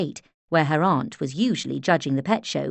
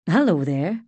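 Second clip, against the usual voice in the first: lightly voiced gentle Highlands greeting; gentle Highlands accent. A narrator's reading. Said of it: "he said in his gentle Highlands accent".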